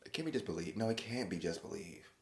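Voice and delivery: usual speaking voice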